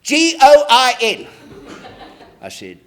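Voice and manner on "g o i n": put-on girl spelling out loud. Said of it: "girl gets up".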